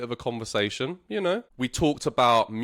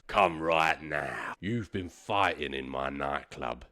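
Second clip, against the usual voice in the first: low, slow, soft commanding voice; stern bouncer; 8 semitones lower, 5 dB quieter than usual. Retold or imitated. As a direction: order him out, low and slow. Bouncer orders him out.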